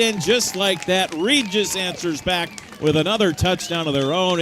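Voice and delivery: excited recap after the score; touchdown call. Real, not acted. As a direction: still loud, calming down.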